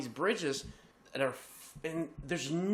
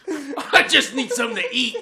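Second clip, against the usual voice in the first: loud, higher, strung-out trucker voice; hammed-up frazzled demand; 8 semitones higher, 7 dB louder than usual. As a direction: plead like a trucker back from a terrifying drive.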